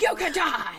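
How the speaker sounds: high-pitched mock yell; retold yell. Mother warning her kids of the danger, mock yelling. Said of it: "I yelled at them".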